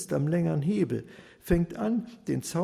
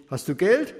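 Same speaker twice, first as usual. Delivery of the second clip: impatient; 3 semitones higher, 6 dB louder than usual. Acted. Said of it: "ganz ungeduldig".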